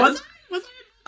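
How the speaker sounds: high, loud laughing question; real laughter. Real, not acted. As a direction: laugh it off in disbelief. Laughing denial.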